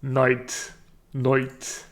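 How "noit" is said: Irish "Noit", oy for long i; Irish accent. Shown as a demonstration. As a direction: turn night into Irish "Noit". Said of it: "practice words with this vowel shift".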